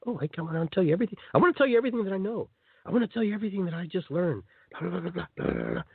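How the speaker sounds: high, fast gushing eagerness; eager babbling. Retold or imitated. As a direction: rush it out like an overexcited convert. Eager sharer voiced.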